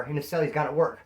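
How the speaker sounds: ordinary delivery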